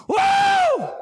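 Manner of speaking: high whooping church shout; high whoop of praise; joyful shout. Acted. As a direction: let out a joyful woo. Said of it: "come up off the ground and".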